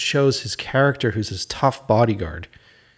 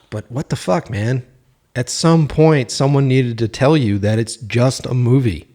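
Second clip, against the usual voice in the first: usual speaking voice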